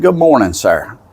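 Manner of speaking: high, lilting hello to a stranger; retold friendly greeting, pitched well up; polite greeting.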